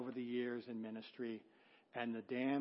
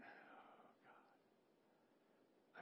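breathless unvoiced oh god; a stunned whisper under the breath; whispered dismay; 29 dB quieter than usual. Preacher seeing the couple, a whispered dismay. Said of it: "I just went".

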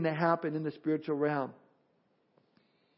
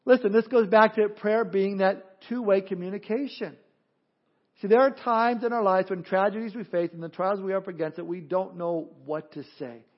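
usual speaking voice

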